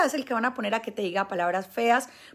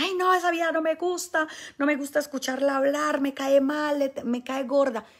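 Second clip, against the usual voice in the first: higher, whiny scornful voice, imitating a hater; mock disgusted complaint; 6 semitones higher, 3 dB louder than usual. Imitating a hater who dislikes the preacher, higher and whiny. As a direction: sneer it out like someone who can't stand her.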